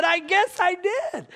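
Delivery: high, drawn-out admission; comic concession. Retold or imitated. Dad admitting his sin to his kid, comically high.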